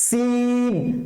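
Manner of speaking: সিন with thin hissed s, vowel held; thin sin. Shown as a demonstration. Teacher recaps letters with students, thin hissing সিন. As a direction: hiss the s of সিন and hold it. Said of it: "সিনটা হচ্ছে পাতলা করে".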